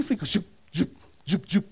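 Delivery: mock sniper shots.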